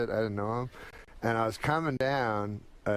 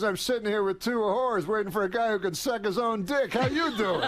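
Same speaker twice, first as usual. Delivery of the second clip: high, indignant comic's comeback; Rodney Dangerfield; 8 semitones higher than usual. Retold or imitated. Comic answers his shocked friend at the Comedy Store, indignant impersonation. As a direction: lightly impersonate an old-school comic, pitched way up. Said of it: "And Rodney goes".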